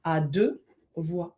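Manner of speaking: slow. Real, not acted. Rereading the viewer's title, slowly.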